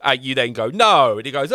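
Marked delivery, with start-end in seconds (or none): 0.7-1.2 mock loud refusal. Acting out refusing the boss.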